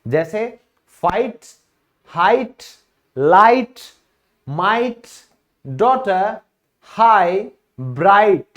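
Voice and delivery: "लाइट", "हाइट" said with no g; slow, spaced list, the gh silent; teacher reading words one by one. Shown as a demonstration. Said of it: "जी silent होता है".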